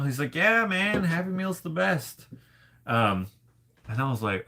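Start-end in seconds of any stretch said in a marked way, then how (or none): 0.3-2.3 high-pitched, keen imitation of a buddy; mock-enthusiastic buddy voice; enthusiastic friend. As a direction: play an eager pal, voice lifted high. Acted enthusiastic friend praising Happy Meals.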